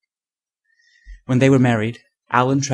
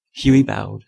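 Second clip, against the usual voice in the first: ordinary delivery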